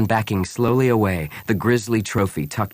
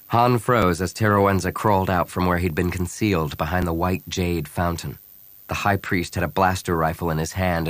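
usual speaking voice

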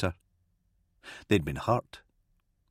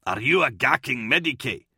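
mildly angry, higher challenge; snapped; 4 semitones higher than usual. A narrator's reading. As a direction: snap impatiently at the old priest. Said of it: "Kalia snapped".

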